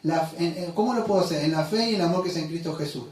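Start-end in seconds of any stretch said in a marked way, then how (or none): 1.4-3.0 En la fe y en el amor read fast; rushed reading. Real, not acted. Short on time, rushes the verse to his church, fast. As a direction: hurry through the verse. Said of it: "Lo voy a decir rápido".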